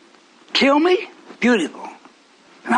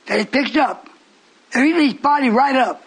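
ordinary talk

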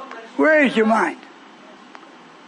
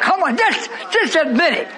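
urgent pleading; 7 semitones higher than usual. Real, not acted. Preacher pressing the congregation.

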